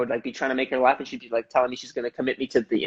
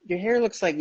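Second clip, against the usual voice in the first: higher mock grandma; a hint of an old Italian grandma; grandma's blunt remark; 6 semitones higher than usual. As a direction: lightly mimic grandma's critique. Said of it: "She'd be like".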